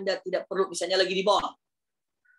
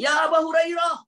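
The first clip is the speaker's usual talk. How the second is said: calling from afar; 10 semitones higher, 4 dB louder than usual. Retold or imitated. Mother calling her son from far away, high shout. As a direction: call a name across a distance. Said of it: "berada jauh, ibunya mengatakan".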